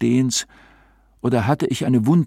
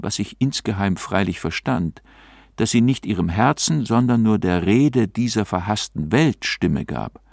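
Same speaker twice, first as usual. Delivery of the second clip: ordinary delivery, nothing marked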